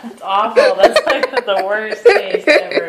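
laughing through real disgust; laugh-cry at the awful taste. Real, not acted. Spoiled milk jelly bean.

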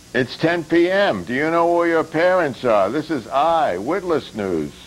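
hammed-up late-night news announcer; mock news anchor. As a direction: sell it like a cheesy TV news promo. Parodying a news promo.

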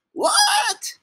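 high squeal of shock; baffled disbelief. Pretend. Reader shocked by the book's words, baffled squeal.

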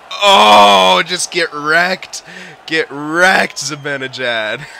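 loud, high gloating yell of get wrecked; gloating shout. Real, not acted. Gamer yelling at the big hit, gloating. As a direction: crow it out loud and slow over the hit.

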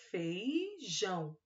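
feijão drawn out slowly, syllable by syllable; teacher spelling out feijão; syllable-stretched dictation. Shown as a demonstration. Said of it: "Então, escreve".

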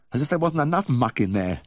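high moaning complaint; moaned. A narrator's reading. Vet complaining to his helper about the muck, moaned. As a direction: raise the pitch into a moan. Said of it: "I moaned".

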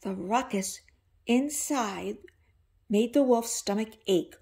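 ordinary delivery, nothing marked

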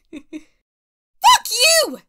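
high, loud yelled insult; angry yell. Acted. Actor recording the quarrel's last line, angry yell. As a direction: yell it out, drawn out.

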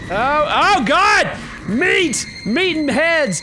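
high, loud, terrified shrieking; high panicked scream. Acted. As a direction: shriek it out in horror. Fran freaks out after medicine.

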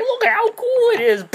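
high squeaky cartoon voice; mock character voice. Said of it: "in the cave, he's like".

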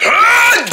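sharp high battle cry; high yell. Acted. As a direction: yell as you charge in. Sink fight.

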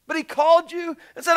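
high, loud fervent preaching. Acted. Preacher urging the congregation, rising fervor. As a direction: lift the room with urgency.

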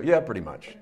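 mock guilty agreement under the breath; imitating a congregation quietly owning up; sheepish admission. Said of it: "most of us go".

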